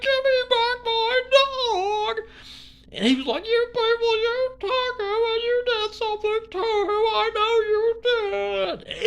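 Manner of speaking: very high, slow, loud wailing plea; mock crying Square. Square pleading for his dog on stream, mock wailing sob. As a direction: beg tearfully like a hammed-up crybaby. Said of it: "He's like".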